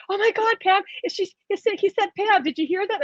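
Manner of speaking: high-pitched, excited gushing; amazed friend. Retold or imitated. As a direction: imitate a friend who can't believe what was heard. Acts out friend's amazed reaction.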